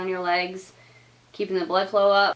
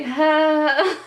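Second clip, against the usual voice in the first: short loud high yelp of pain; hurt gasp while clutching a pillow; pained cry; 7 semitones higher, 5 dB louder than usual. Retold or imitated. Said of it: "had to grab that pillow and was like".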